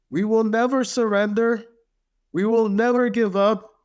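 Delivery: Winston Churchill. Acted. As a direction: proclaim it defiantly in a sustained high tone. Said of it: "that's my best Winston Churchill".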